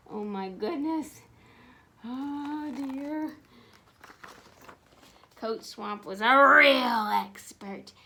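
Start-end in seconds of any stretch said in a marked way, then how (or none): none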